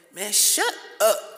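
loud, high, slow shut up; angry shout. Acted. To people saying he's complaining, a loud angry shout. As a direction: shout it to cut them off. Snapping back at critics calling him complainer.

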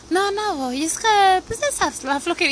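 high, sheepish mock confession with a laugh; lazy friend. Friend confessing she skips the gym, mocked high. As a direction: play the friend owning up to laziness. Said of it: "muchos de mis conocidos me dicen".

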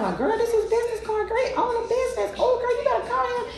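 very high, put-on gushing voice; high-pitched hyped-up friend impression; mock excited girlfriends. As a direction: imitate girlfriends squealing over a business card. Imitating girlfriends seeing the business card.

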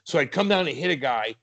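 ordinary talk

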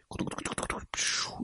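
quick whispered bubbles; bubbling sound. A narrator's reading. Narrator voicing plesiosaur diving underwater, whispered bubbles. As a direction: gurgle it softly and fast.

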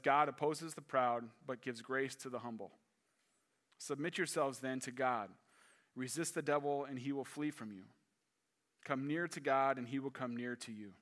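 soft, measured Bible reading; scripture reading. Acted. Reading James four to the congregation, soft and measured. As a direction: read it to the flock.

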